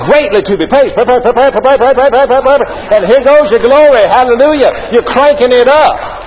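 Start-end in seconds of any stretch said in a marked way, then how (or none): 0.9-2.7 rising, louder revving syllables; mock motor revving up; engine revving up. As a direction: rev it like an engine catching.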